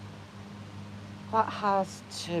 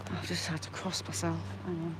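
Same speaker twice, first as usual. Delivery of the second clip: hushed; 3 semitones lower, 13 dB quieter, faster than usual. Real, not acted. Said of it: "hushed after swearing".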